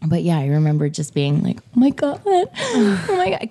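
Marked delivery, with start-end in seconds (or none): 1.8-3.5 high-pitched retold shock; hammed-up gasping disbelief; mock scandalized. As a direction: imitate a shocked kid, second time drawn out. Retells childhood shock at swear word.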